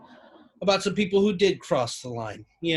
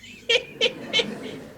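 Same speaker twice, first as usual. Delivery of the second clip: very high nasal cackle; little mocking laugh. Dad Bob after his Lieutenant Dan joke, high little laugh. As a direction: giggle high, pleased with your own joke. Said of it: "He does a little laugh, too".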